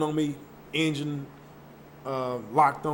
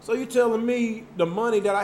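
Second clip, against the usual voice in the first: retold anger; 7 semitones higher than usual. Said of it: "I was like".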